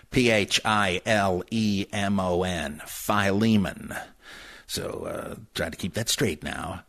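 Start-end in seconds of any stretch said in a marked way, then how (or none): 2.8-3.9 "Philemon" as fye-LEE-mun; correct pronunciation. Shown as a demonstration. After spelling it out, "Philemon" correctly as fye-LEE-mun. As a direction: stress the LEE in "Philemon", first syllable fye. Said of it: "you've heard it mispronounced as Philemon".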